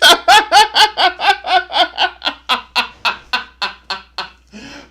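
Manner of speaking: long, high, loud laughter; helpless shrieking laugh. Real, not acted. Laughing hard.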